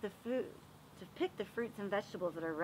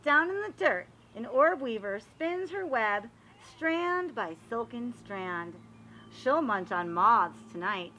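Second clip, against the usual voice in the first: storybook narration; 6 dB louder, slower than usual. Acted. Teacher reading a garden book aloud to the class, storybook voice. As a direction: read it like a picture book for little kids. Reads picture book to children.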